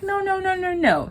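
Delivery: high rapid-fire refusal; mock objection. Maurice stopping a suspicious man at the bank, mock objection. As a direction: pitch it way up on one held level.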